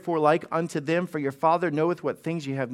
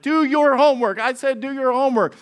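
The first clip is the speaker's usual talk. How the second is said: higher, louder stern mom; mock scolding mom; 7 semitones higher, 4 dB louder than usual. Mom nagging kids about homework, mock stern. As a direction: bark it like a fed-up mom. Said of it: "she's just going at it like".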